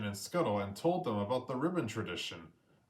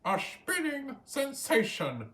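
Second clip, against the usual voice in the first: squawking praise; 9 semitones higher than usual. A narrator's reading. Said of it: "squawked Scuttle".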